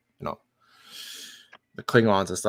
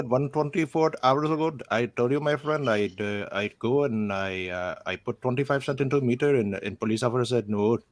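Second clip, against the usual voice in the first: put-on Indian accent; 3 semitones higher than usual. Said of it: "but back in India".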